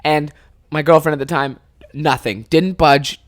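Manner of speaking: ordinary talk, nothing marked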